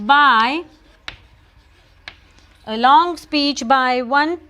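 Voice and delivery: slow dictation in short chunks; dictating. Real, not acted. Teacher writing a word's meaning for students, slow dictation. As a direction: draw each phrase out for note-takers.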